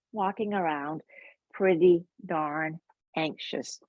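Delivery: ordinary talk, nothing marked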